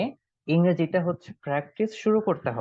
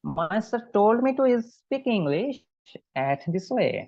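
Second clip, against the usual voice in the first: slow higher model answer; 6 semitones higher, much slower than usual. Shown as a demonstration. Student answering elder brother in English, slow and raised. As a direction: answer line said slowly and clearly higher. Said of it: "তারপরে বাংলা বলেন যে".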